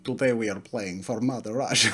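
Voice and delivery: put-on Russian accent. Host rallies for Mother Russia in a put-on Russian accent. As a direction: let the mock accent drift into Russian. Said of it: "That was a little bit Russian".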